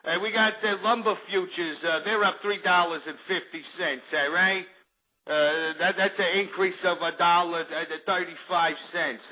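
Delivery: market prices read in a hammed-up Jersey Shore accent. Host reading futures prices to the kids, mock Jersey Shore accent. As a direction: keep up the mock Jersey tough-guy talk. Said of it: "let me talk like this".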